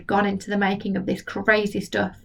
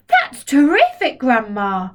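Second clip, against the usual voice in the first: put-on higher, louder delighted boy; cried; 3 semitones higher, 4 dB louder, slower than usual. George cheers Grandma standing up, higher and louder. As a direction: cry out in delight. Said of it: "George cried".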